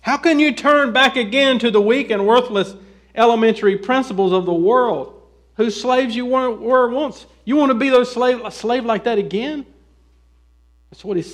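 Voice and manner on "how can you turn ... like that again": raised, louder, pressing questions; indignant challenge. Acted. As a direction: challenge them as if calling them to repent. Preacher voices apostle's indignant challenge.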